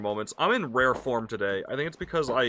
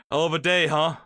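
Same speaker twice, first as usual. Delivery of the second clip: dad voice; 3 dB louder than usual. Acted. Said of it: "let you out-dad me".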